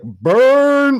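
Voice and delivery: high, loud, drawn-out taunt; long gleeful holler; jeering shout. Acted. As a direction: crow over a savage put-down. Mock cheer at a devastating insult.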